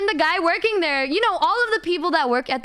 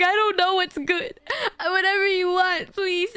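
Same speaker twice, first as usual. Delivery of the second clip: high, whimpering helplessness; panicked tearful plea; 4 semitones higher than usual. Retold or imitated. Flustered customer asked what to get, tearful panic. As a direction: imitate being close to tears, begging for help. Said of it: "as I'm panicking and crying".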